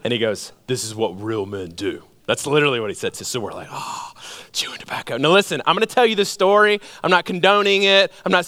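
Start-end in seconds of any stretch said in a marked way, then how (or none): 0.7-2.0 tough uncle. Retold or imitated. Uncle quoted at tobacco aisle. 3.7-5.2 hushed, impressed whisper; awed whisper. Retold or imitated. Boys awed by tobacco aisle.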